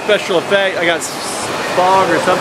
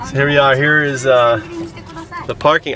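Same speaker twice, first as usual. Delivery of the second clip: ordinary delivery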